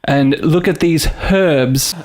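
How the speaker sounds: slow, hammed-up British accent with a sounded H; mock-British presenting, slowly; English accent. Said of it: "in the English way".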